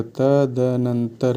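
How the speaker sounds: तदनंतर said slowly, syllable by syllable; slow clear demo. Shown as a demonstration. Teacher slowly sounding out तदनंतर for the class. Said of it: "द पे हलंत होगा".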